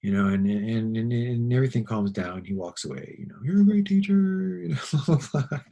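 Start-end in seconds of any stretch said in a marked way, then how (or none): 3.4-5.6 high and loud, a sing-song parting compliment; mock dad. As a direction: mimic the dad, half teasing. Imitating the dad's parting words.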